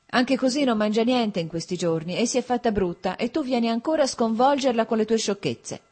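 fussing mother-in-law's reproach; scolding. A narrator's reading. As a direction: chide him in mild anger. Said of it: "si arrabbiò e lo mandò a casa".

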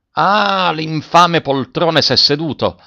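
high, drawn-out cry of outrage; furious curse. A narrator's reading. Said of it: "gettò un'orribile imprecazione".

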